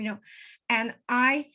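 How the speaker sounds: ordinary talk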